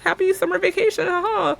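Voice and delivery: high, loud, over-bright pleasantries; fake cheerful small talk. Stylist faking familiarity with a stranger, high and overbright. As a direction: cover not knowing her with cheer. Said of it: "And I'm like".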